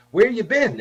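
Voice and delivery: loud, high mock question; mimicking nosy commenters. Guest imitating people on Facebook, loud and high. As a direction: call it out like a pestering commenter.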